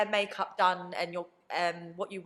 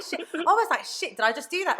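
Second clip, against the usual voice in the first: shocked higher, louder exclaim; higher, louder panic; 9 semitones higher, 5 dB louder than usual. Retold or imitated. Recalling her TV accent, higher louder panic. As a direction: gasp it out in alarm. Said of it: "horrified at own accent".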